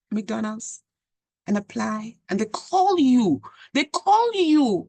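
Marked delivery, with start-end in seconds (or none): 2.3-3.4 rising amazement, slowed down; emphatic. Real, not acted. Amazed at getting job calls. 3.7-4.8 higher and louder, slowed down; more emphatic. Real, not acted. Repeats it with more force.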